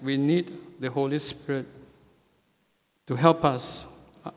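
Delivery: usual speaking voice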